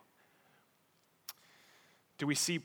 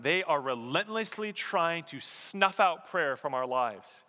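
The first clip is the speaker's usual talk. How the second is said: normal talking voice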